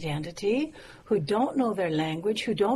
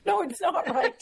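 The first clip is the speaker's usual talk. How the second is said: laughing; 8 semitones higher than usual. Real, not acted. Laughing at her own word slip.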